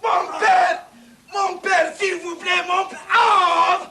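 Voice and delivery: urgent cries for help; desperate shouting, hurting; calling out in pain. Acted. Wounded soldier calling for help. (appel au secours, crié de douleur)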